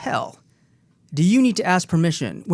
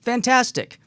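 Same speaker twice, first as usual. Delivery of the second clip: retold enthusiasm; 6 semitones higher, slower than usual. Retold enthusiastic coaching reply.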